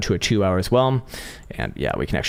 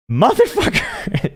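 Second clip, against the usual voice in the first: real exasperated shout, much higher and slow; frustrated yell; 13 semitones higher, 4 dB louder, much slower than usual. Real, not acted. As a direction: blurt it out, fed up with yourself. Finds the chart already listed.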